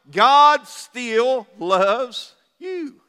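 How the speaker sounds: very slow, each word weighted; slow emphatic. Acted. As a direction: let it land slowly. Sermon climax, drawn out.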